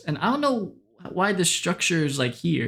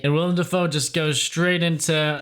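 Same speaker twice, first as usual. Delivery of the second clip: ordinary talk, nothing marked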